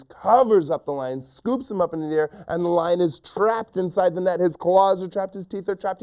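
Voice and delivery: high, excited action storytelling; urgent narration. A narrator's reading. Narrator telling children of the trapped lion, high urgent telling. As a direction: drive through the struggle breathlessly high.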